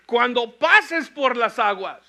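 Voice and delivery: loud, high, slow emphatic reading of "Cuando pases por las aguas"; emphatic repeat. Shown as a demonstration. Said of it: "Una vez más".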